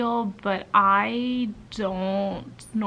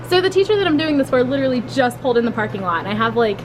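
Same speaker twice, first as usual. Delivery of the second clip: frantic rush; 3 semitones higher, 9 dB louder, faster than usual. Real, not acted. Racing to deliver before teacher arrives.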